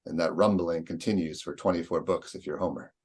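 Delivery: ordinary delivery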